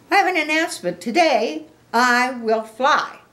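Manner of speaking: high, loud, excited pig character; Piggy announcing. Acted. Piggy jumps up to tell Gerald her news, high and proclaiming. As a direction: proudly declare it in a high, loud voice.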